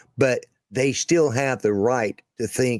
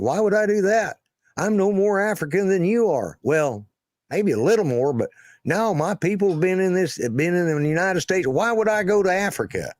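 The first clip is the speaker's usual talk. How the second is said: indignant jazz player; 8 semitones higher than usual. Retold or imitated. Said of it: "He said".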